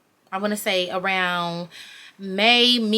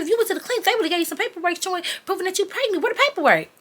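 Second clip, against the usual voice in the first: very high, insistent mom voice; mock challenging mom; 11 semitones higher, faster than usual. Mom challenging her daughter for clinic papers, high and insistent. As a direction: imitate a doubting mother demanding proof. Mom retold demanding proof of pregnancy.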